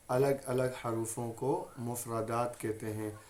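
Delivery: ordinary delivery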